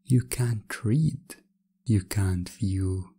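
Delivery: ordinary talk, nothing marked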